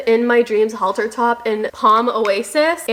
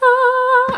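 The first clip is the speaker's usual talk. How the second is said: excited squeal; 13 semitones higher, 4 dB louder than usual. Real, not acted. Squeal at festival set.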